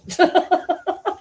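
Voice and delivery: high, quick chuckle; real giggle, pitched high; amused laugh. Real, not acted. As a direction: laugh at the dog's cheek. Amused at the dog staying.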